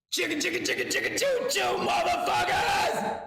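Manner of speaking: cracking high strained puppet yell; a bad Kermit trying to yell. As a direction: scream it as Kermit until the voice breaks. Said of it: "I try to yell and my Kermit goes bad".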